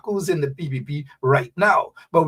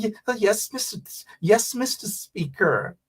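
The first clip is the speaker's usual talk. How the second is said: hushed, over-proper British imitation; prissy put-on British voice; mock British accent; 2 semitones higher, 6 dB quieter than usual. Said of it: "as though they were born in the same hospital as Margaret Thatcher".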